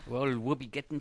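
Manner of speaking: light attempt at an Irish accent; bad Irish brogue. Co-host asked about today's topics, badly faked Irish brogue. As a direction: try an Irish brogue and miss. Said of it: "for a guy who is actually Irish".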